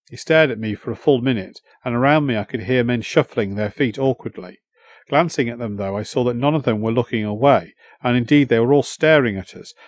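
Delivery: plain reading voice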